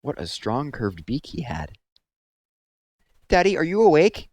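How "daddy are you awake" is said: high and loud, calling up to Daddy; loud owlet call. A narrator's reading. Little owl loudly waking his sleeping father. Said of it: "he said loudly".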